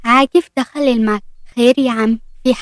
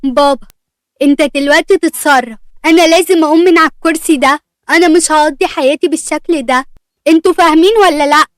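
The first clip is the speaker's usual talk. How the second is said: much higher and louder, pleading shout; desperate outburst; 4 semitones higher, 3 dB louder than usual. Acted. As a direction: demand they act, at the end of her rope. Daughter pleads angrily with family. (صرخة يائسة بصوت أعلى بكتير)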